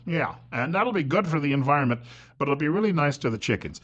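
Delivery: usual speaking voice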